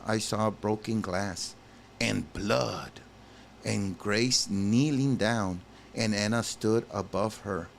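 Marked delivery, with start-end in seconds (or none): none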